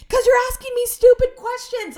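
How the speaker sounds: high, loud exasperated yell; fed-up shouted retort; mad. Retold or imitated. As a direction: shriek it in frustration. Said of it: "Why are you so mad?".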